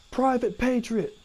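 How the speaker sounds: high, slow roll-call bark; drill sergeant. Retold or imitated. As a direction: call out the recruit's name in formation. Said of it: "He says again".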